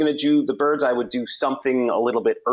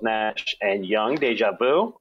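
ordinary delivery

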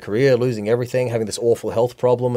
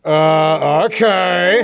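doubtful; 4 semitones higher, 6 dB louder, much slower than usual. Retold or imitated. Club's doubtful reaction.

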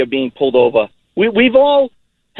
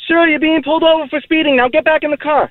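yelling cop; 12 semitones higher than usual. Pretend. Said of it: "He's not gonna yell and say".

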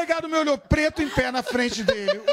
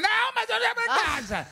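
much higher, strained mimicking rant; mock exasperation; 11 semitones higher than usual. Comedian mimics the co-host ranting on, high mock exasperation. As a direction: whine it high in mock despair.

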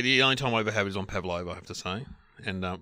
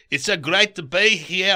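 higher, louder, slow South African; South African accent; 7 semitones higher, 6 dB louder, slower than usual. Retold or imitated. Award winner starting his speech, South African accent. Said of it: "accents that I can do is South African".

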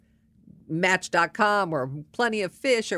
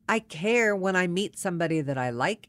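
ordinary talk

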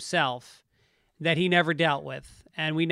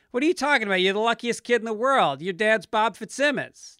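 higher-pitched mock protest; imitated dismissive disbelief; 6 semitones higher than usual. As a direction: brush off the complaint as if it were absurd. Voices others dismissing his complaint.

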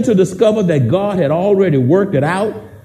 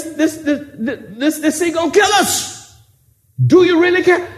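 high, frantic, pleading; disciples panicking; 7 semitones higher than usual. Acted. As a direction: beg him in terror in the storm. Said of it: "they asked him".

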